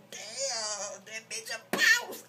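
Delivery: a high, loud squeal of approval; impressed exclaim. Retold or imitated. To herself at the comeback, a high, loud thrilled squeal. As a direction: gasp it out like she just won.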